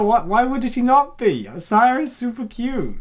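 ordinary talk, nothing marked

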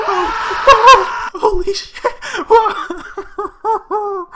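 very loud, high screams of shock; real scared screaming. Real, not acted. That scared the life out of me.

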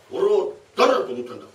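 loud thief's threat; menacing demand. Acted. A thief loudly threatening the tenant, menacing. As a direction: bark out who he is.

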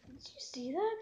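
soft breathy whisper. Real, not acted. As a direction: whisper it to the viewers. Whispered aside.

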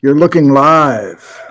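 TV announcer. Acted. Host opening the livestream to viewers, booming announcer voice. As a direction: welcome them like a live TV anchor.

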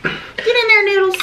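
high, eager cheering; root for it; excited cheer. Real, not acted. Cheering the pasta onto fork.

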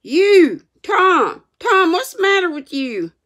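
loud, high frightened cry; panicked aunt at a bedside; gasped out. A narrator's reading. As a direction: cry out in breathless alarm. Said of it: "she gasped out".

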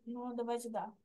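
usual speaking voice

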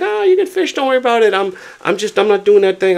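lightly imitated friendly stranger; fisherman's reassurance. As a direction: pitch it up to play the easygoing stranger. Voicing the fly fisherman.

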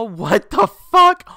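high, loud, drawn-out outburst; shocked. Real, not acted. Reacting to a battle pass reward, shocked outburst. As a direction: stretch it out in disbelief.

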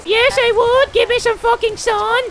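very high, loud cartoon plant voice; a tiny plant demanding sun; squeaky angry plant. The houseplant answering its owner in a shrill squeak.

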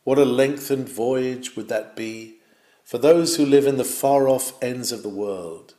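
ordinary delivery

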